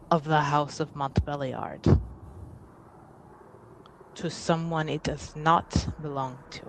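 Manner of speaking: slow, deliberate formal speech with weighty pauses; composed, affronted noble. Acted. Ade names the house's primary concern, slow and stately. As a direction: state a grievance with measured authority.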